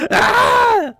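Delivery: very high, loud shriek; excited scream. Acted. Mail has arrived.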